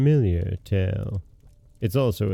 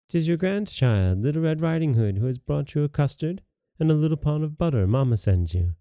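put-on higher, trusting storybook child; little girl voice; 5 semitones higher than usual. Little girl answering at grandmother's door, higher child voice. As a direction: lift the pitch for an innocent girl.

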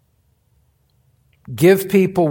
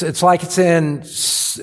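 normal talking voice

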